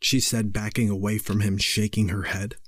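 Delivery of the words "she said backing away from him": plain reading voice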